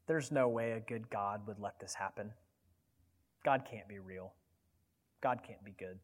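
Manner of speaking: soft, low murmur of doubt; insinuating whisper. Acted. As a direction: drop to a hushed tempter's voice. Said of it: "Satan is going to whisper into your ear".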